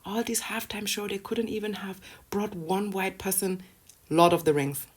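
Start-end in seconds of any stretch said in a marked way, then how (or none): 0.0-3.6 soft, high, put-on whine; quietly whiny mock complaint; complaining viewer. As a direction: mimic an aggrieved viewer in a small high voice. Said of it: "you're like".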